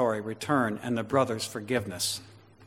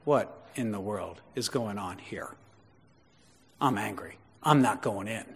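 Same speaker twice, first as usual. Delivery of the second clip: put-on sulky older brother; 5 semitones lower, 6 dB quieter than usual. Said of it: "See his arm behind his back".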